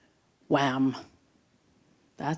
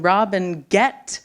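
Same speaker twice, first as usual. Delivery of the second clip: higher and louder, firm; self pep-talk; 4 semitones higher, 6 dB louder, much slower than usual. Real, not acted. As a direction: kick yourself into action. Ordering herself to move on.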